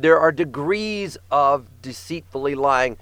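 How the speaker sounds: ordinary talk, nothing marked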